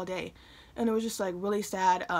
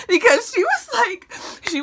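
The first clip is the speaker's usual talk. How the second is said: laughing; 13 semitones higher, 9 dB louder than usual. Real, not acted. Laughing as she sets up her mom's outburst. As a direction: laugh high and loud into the words. Laughing while telling story.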